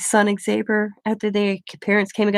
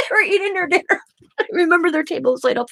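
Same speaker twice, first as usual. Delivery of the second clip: laughing through the words; real laughter; 7 semitones higher, faster than usual. Real, not acted. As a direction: laugh as you remember it, talking fast. Laughs remembering the dinner scene.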